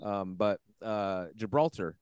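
talking through a mouthful; words round a bite of food; mouth full. Real, not acted. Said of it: "I have something in my mouth".

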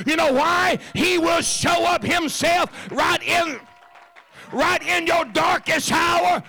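high, loud preacher shout; preaching at full force. Acted. Pastor preaching on the darkness to the church, shouting. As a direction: ring it out to the back pew.

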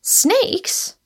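high, rising puzzled question; curious, high-pitched query; puzzled interest. A narrator's reading. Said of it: "inquired Rosamond with a slightly puzzled interest".

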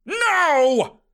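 high, drawn-out protesting cry; screamed refusal. A narrator's reading. Uncle refuses to be left behind, screaming. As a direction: wail it in outrage. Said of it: "Horbert screamed".

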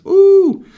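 shrill, loud mock whoop; loud high whoop. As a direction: let out a big celebratory whoop. Mock street celebration.

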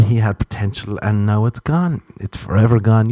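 normal talking voice

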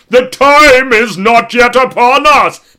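high, loud, slow booming ghost cry; ghostly scream. Pretend. A ghost rising from his body screams the warning. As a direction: wail it out like an old ghost.

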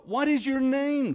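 high-pitched insistent question; urgent Moses questioning God. Retold or imitated. Moses asks God his name, urgently insistent. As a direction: ask it high and pressing. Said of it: "Moses is asking God".

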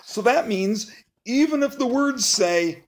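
ordinary delivery

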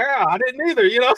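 high, loud, amused; high amused shrug; joking reply. Retold or imitated. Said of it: "I'm like".

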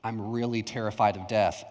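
low, slightly hushed sheepish confession. Retold or imitated. Ashamed teen confessing to his student pastor, sheepish. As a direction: admit it reluctantly, imitating your embarrassed younger self. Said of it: "you go in kinda sheepishly".

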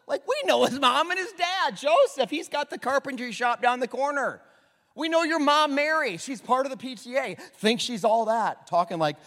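high, loud nosy neighbour voice; gossiping townsfolk. Acted. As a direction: play the snooty townsfolk. Townspeople voiced gossiping about Jesus.

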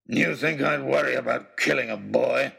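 villain snarling contempt. Acted. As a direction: sneer it down at the boy. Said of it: "he snarled".

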